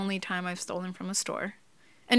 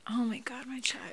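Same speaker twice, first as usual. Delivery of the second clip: soft near-whisper of dismay; pained hush; 4 semitones higher, 9 dB quieter than usual. Real, not acted. Mother reacts to child's ordeal.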